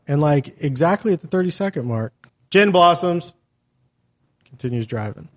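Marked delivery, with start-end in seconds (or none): 2.5-3.3 mock announcement. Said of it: "he announces to the car".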